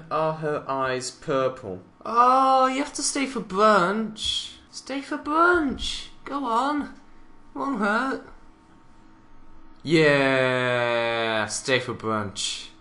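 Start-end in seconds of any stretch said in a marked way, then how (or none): 2.1-8.3 high, drawn-out pleading; mock coaxing. Commentator mimics urging a pony to stay for brunch, high coaxing. 9.8-12.7 low, slow, drawn-out voice; low mock invitation. Commentator echoes the brunch invitation, low and drawn out.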